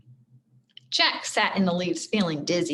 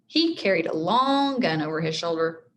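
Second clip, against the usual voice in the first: ordinary delivery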